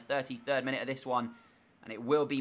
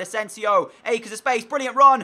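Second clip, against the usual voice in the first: higher and louder word by word into a shout; rising alarm; 9 semitones higher, 12 dB louder than usual. Real, not acted. Gamer commentating the attacker's run, building to an alarmed shout. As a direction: build panic as the run goes on. Opponent breaks through on goal.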